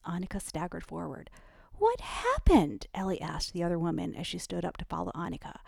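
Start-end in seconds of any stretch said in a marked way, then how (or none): 1.7-2.9 high, slow alarmed question; worried and urgent, reaching up high. A narrator's reading. Ellie asking the other woman what went wrong, alarmed. Ellie startled by friend's distress.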